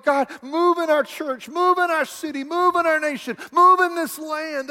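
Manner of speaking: building from measured plea to high, urgent cry; desperate prayer. Retold or imitated. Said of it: "They're crying out in desperation".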